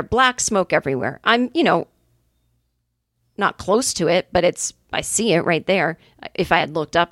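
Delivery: normal talking voice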